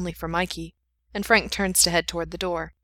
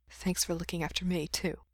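hushed; 9 dB quieter than usual. Acted. Hugging, adding a private thanks.

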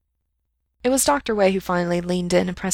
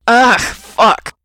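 sudden loud cry of pain; pained gasp; 4 semitones higher, 12 dB louder than usual. A narrator's reading. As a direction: yelp it out as pain strikes. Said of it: "he gasped".

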